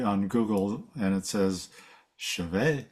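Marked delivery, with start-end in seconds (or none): 2.2-2.9 chevet as shuh-VAY, silent T, lifting at the end; quoted pronunciation guide. Retold or imitated. Quoting an online pronunciation search, chevet as careful shuh-VAY. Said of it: "How do you pronounce this word?".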